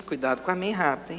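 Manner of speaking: ordinary talk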